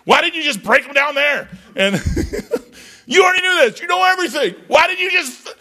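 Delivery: high, fast, squeaky whine at the Lord; indignant high-pitched griping; mock exasperated complaint to God. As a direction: whine it up high like a scolded kid. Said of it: "I'm like".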